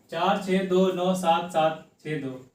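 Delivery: slow, each digit read separately as 'चार छः दो नौ सात सात छः दो'. Shown as a demonstration. Teacher shows the class the wrong way, slow digit by digit. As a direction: spell out the number one digit at a time. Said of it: "ऐसे पढ़ेंगे क्या? बिल्कुल नहीं".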